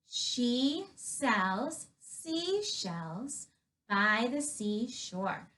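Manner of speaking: put-on slow, careful repeat for children; slow practice read. Shown as a demonstration. Teacher repeating the twister slowly for young students. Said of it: "The first one is".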